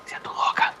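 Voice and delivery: voiceless in-character whisper. Acted. Companions argue over hiding by the fire, whispering. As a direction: whisper it under threat of being overheard.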